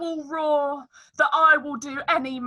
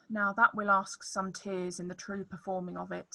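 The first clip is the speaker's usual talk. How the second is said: usual speaking voice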